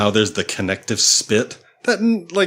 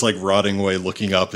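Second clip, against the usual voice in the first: ordinary delivery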